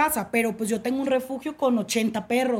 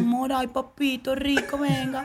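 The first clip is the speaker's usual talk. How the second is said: paisa cantadito, sin prisa; acento de Medellín cantadito; 4 dB quieter than usual. Shown as a demonstration. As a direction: canturrea suavemente como paisa de Medellín. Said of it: "todo cantadito". (acento paisa, cantadito y meloso)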